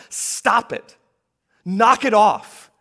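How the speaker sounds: loud, high, slow stern command; stern rebuke. Shown as a demonstration. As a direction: snap them to attention and stop them. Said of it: "The real word is more like".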